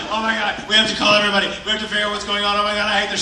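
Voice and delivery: high, fast, loud panic; panicked rush. Retold or imitated. To Brina, a fast panicked rush. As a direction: rattle it off in a frenzy. Panicked plan to call everyone.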